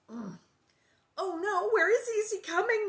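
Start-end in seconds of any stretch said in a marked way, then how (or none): 1.2-2.8 high, loud scared questions; frightened. A narrator's reading. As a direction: panicky girl hiding. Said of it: "Nellie asked".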